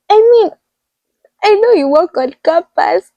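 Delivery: high-pitched mock exasperation; acted-out frustrated clarifying; exasperated correction. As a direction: pitch it up, protesting the misunderstanding. Acts out her exasperated correction.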